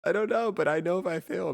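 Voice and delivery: high, bright excited burst. Real, not acted. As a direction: blurt it out high. Excited rising pitch.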